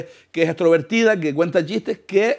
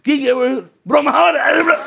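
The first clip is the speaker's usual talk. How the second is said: higher, louder annoyed cry; exasperated friend; 8 semitones higher, 4 dB louder than usual. Retold or imitated. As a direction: snap back at a joker in a crisis. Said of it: "¿Y qué dijo mi colega?".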